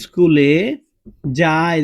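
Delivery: slow and loud, dwelling on যায়; slow example sentence. Shown as a demonstration. Teacher dictating an example to students, slow and loud. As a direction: spell out স্কুলে যায় with long pauses, stretching যায়. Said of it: "যেমন দেখো সে".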